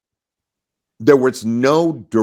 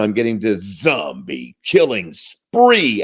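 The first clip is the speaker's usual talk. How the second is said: normal talking voice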